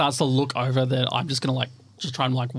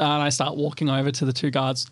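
normal talking voice

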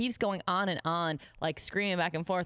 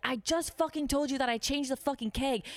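high-pitched imitated tirade; berating boss; 9 semitones higher than usual. Boss berating waitress over the keg, imitated anger. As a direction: act out an exasperated scolding.

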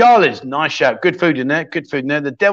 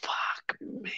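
breathless whisper under the breath; whispered swear; 19 dB quieter than usual. Real, not acted. Streamer whispering in shock to himself.

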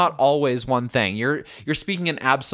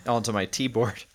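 usual speaking voice

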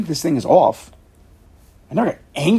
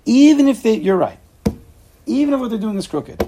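much higher and louder, pressing the point; emphatic challenge; 10 semitones higher, 7 dB louder than usual. Real, not acted. Teacher challenges the angry donor, high and emphatic. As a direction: push back hard on the objection.

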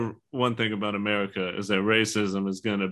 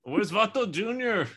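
high, loud Chicano dad voice, mixing in Spanish; Mexican dad voice; 12 semitones higher, 3 dB louder than usual. Pretend. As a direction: call out like a hammed-up Chicano dad. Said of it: "Dad, that's not your voice".

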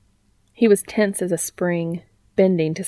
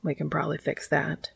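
hushed, intimate reassurance; murmur; 7 dB quieter than usual. A narrator's reading. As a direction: murmur it close and gently. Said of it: "Dean murmured".

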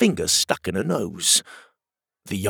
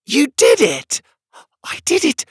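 very high, delighted little-creature squeal; excited; 6 dB louder than usual. A narrator's reading. Little porcupine thanking Pockets after whistling, very excited. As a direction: burst out high with thrilled thanks. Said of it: "The excited porcupine".

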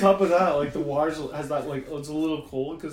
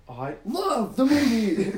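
higher, louder, slow gushing through a laugh; mock delight; 7 semitones higher, 7 dB louder, slower than usual. Comedian faking enthusiasm to a chatty kid, laughing gush. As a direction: overplay a grown man's fake enthusiasm. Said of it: "the guy in the queen's like".

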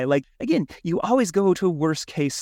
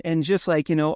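usual speaking voice

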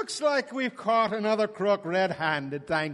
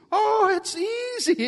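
mock realisation; 11 semitones higher than usual. Villain drawn-out mock realisation.

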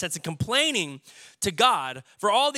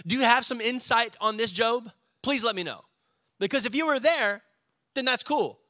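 sarcastic God; 7 semitones higher than usual. Retold or imitated. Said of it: "He says".

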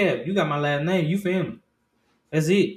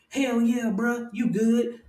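higher, slow, hyped-up dude voice; mock hype bro; 8 semitones higher, slower than usual. Dad mocking a too-cool reaction, slow and hyped. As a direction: play a fake-enthusiastic bro. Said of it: "right here and be like".

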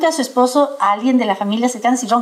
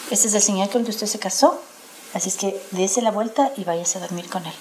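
lower, softer, calm repeat of the aunt's words; quiet aunt's advice; 5 semitones lower, 7 dB quieter than usual. Retold or imitated. Aunt quietly repeating her advice to the tired bride, softer and lower. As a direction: say it again lower and softer, settled. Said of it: "Entonces me dijo".

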